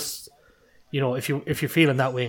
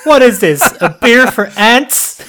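high, loud, slow outraged mockery; impression of Walshy; 10 semitones higher, 13 dB louder, slower than usual. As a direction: scoff at the tiny beer. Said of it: "bad impression of Walshy Mark just did".

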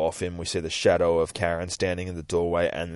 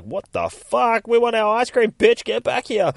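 put-on high, loud indignant kids; kids' angry yelling; 13 semitones higher, 5 dB louder than usual. Kids shouting at the ice cream van driving off, angry yelling. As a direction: yell after the van in a raised, outraged voice.

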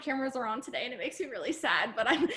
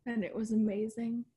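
mocking a dull seller; 6 dB quieter than usual. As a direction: drag it out like a boring live video nobody wants to watch. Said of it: "she went on like that for, like, five freaking minutes".

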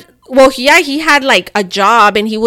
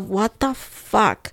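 slow, stretched-out disbelief; drawn out; 6 dB quieter, much slower than usual. Retold or imitated. Thinking to herself at the bills, slow stunned disbelief. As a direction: let it drag out, stunned.

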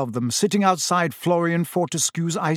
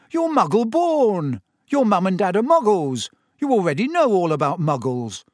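high, insistent disbelief easing down; incredulous; 5 semitones higher, 3 dB louder than usual. A narrator's reading. Boy questions friend taking Muggle studies, incredulous. Friend baffled by her subject choice.

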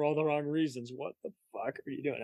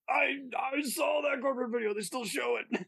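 excited shout; 13 semitones higher than usual. Acted. Excited fan spots him.

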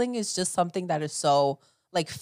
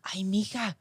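excited mom; 8 semitones higher than usual. Retold or imitated.

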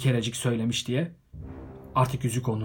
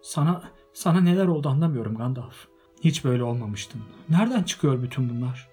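frightened plea; 6 semitones higher, 7 dB louder than usual. A narrator's reading. Bilbo frightened pleading.

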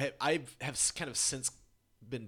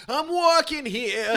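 put-on New York accent; 11 semitones higher, 10 dB louder, much slower than usual. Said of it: "was he like".

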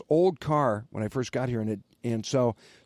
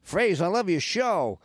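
put-on high, drawn-out Boston accent; amateur Boston accent, pitched up; 5 semitones higher, slower than usual. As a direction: hail a pal in a slow Boston accent. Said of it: "That's my best Boston accent".

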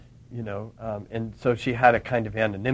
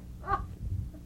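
soft breathy laugh; 5 dB quieter than usual. Real, not acted. Laugh after funny quote.

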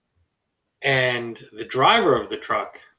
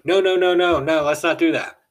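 high, loud rushed no no no; four friends hastily backing off in alarm; a sudden chorus of nervous refusal; panicked backing down; 6 semitones higher, 6 dB louder than usual. Retold or imitated. Group panics at police threat.

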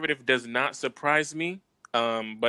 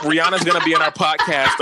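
loud, laughing proclamation; excited declaration; 2 semitones higher, 5 dB louder than usual. Real, not acted. Co-host manifesting a dream guest, loud and laughing. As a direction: announce it loudly through a laugh. Excited wish, then repeated calmly.

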